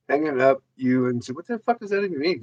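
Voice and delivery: ordinary talk, nothing marked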